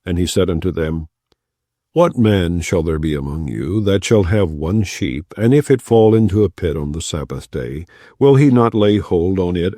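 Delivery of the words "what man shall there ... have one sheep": usual speaking voice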